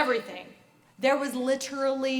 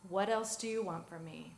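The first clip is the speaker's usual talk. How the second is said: quiet retelling; 7 semitones lower, 7 dB quieter than usual. Retold or imitated. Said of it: "he said it quietly".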